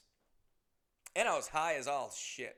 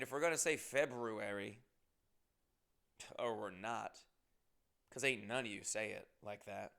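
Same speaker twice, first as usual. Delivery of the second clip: usual speaking voice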